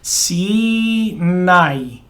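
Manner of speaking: Sinai slowly drawn out as see-nai; teacher exaggerating the Hebrew vowel; elongated demo. Shown as a demonstration. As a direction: stretch Sinai oddly long on purpose. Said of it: "I'm elongating it and saying it kinda weird".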